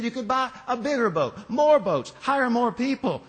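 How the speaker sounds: irritated businessman. Acted. Irritated businessman badgering the fisherman, high and loud. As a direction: push it, losing patience. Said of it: "the businessman was getting irritated".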